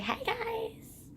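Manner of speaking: high, soft sing-song mermaid. Retold or imitated. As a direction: greet them like a sweet mermaid. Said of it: "a mermaid just pops out and is like".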